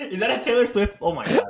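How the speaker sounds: high, laughing disbelief; real laugh breaking through; delighted laughing shock; laughing surprise. Real, not acted. Surprised laughter about song lyric.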